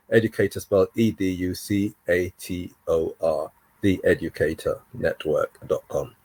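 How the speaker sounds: low, soft, letter-by-letter spelling; dictation. Shown as a demonstration. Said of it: "Imagine trying to write that down".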